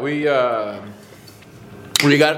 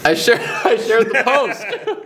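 higher, slow, broken up by laughter; laughing; 7 semitones higher, slower than usual. Real, not acted. Host defending his lack of donation, laughing. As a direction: crack up midway through saying it. Cracks up repeating own claim.

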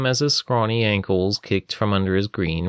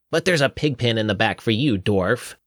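mocking; 2 semitones higher than usual. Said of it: "demeanor changed as he rounded upon".

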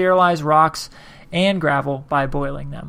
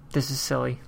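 sighing; 4 semitones lower, 13 dB quieter than usual. Real, not acted. Sighed remark.

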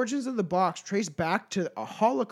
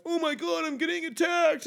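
much higher, hammed-up fake panic; mocking a ghost hunter; 11 semitones higher than usual. Mocking fake-scared ghost hunters on TV, shrill panic. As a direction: overplay the fright like a TV ghost hunter. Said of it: "over-exaggerate everything".

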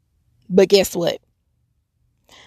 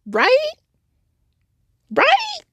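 emphatic. Real, not acted. Preaching call for agreement.

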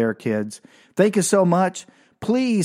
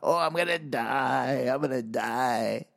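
slow, soft whimper of doom; mock frozen dread; 2 semitones lower, 6 dB quieter, slower than usual. Boy sees the paddle glint, soft mock whimper of dread. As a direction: whimper it slowly, hammed-up terror. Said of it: "froze me".

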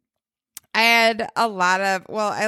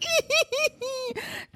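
squealing laugh; 7 dB quieter than usual. Real, not acted. Laughing at screaming kids photo.

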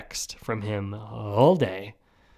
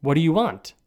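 higher-pitched mock rude demand; 4 semitones higher, 4 dB louder than usual. Hotel clerk greeting an arriving guest, imitated rudely. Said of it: "he very rudely says".

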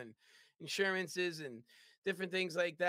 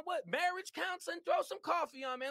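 much higher, eager rapid offers; frantic excitement; 10 semitones higher than usual. Retold or imitated. Salesman offering anything to a customer, high eager pitch. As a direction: keep the desperate pitch racing. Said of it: "So I'm getting excited".

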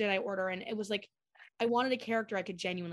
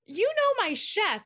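high, slow, louder nervous question; timid high question; 12 semitones higher, 6 dB louder, slower than usual. Retold or imitated. Nervous fan asking Ashley about her chef, high and timid. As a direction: ask it shyly, voice up high. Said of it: "And I went".

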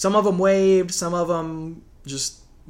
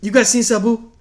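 mock wrestler question; 5 semitones higher, 6 dB louder than usual. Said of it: "He asked us if we'd seen".

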